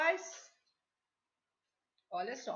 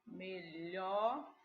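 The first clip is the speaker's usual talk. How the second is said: "melhor" stretched out, low; slow dictation of "melhor"; sounding out; 4 semitones lower than usual. Shown as a demonstration. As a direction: draw out each syllable of "melhor" while writing. Sounding out while writing the word.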